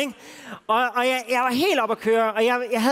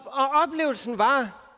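usual speaking voice

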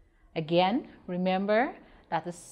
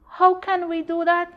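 dog's question, much higher and louder; puzzled dog; 11 semitones higher, 6 dB louder than usual. Acted. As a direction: ask like a baffled old dog. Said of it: "asked the dog".

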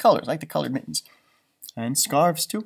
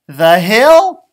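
higher and louder, eager; eager suggestion; 5 semitones higher, 12 dB louder than usual. Acted. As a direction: call out the best idea. Character suggests a sledding place.

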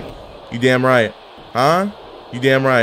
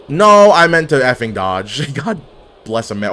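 usual speaking voice

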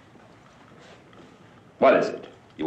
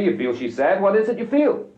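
higher, rising insistent questioning; exasperated demand; 11 semitones higher, 3 dB louder than usual. Acted. Director pressing his actress about her part, exasperated. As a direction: press hard for an answer, voice climbing.

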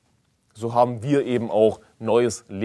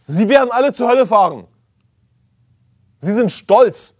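higher, louder thundering sermon against false teachers; fiery preaching; 7 semitones higher, 5 dB louder than usual. Acted. Preacher denouncing false teachers in German churches, fiery shout. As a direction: rail at the false teachers from the pulpit.

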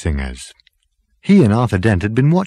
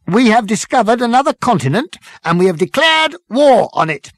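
high, loud parade-ground reporting; zealous military subordinate; 13 semitones higher, 4 dB louder than usual. Acted. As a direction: report to the captain like an eager officer. Number Two reporting to captain.

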